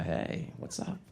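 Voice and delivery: soft, breathy nervous question; hushed. Retold or imitated. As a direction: ask gently, half whispered. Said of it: "I'm like, uh".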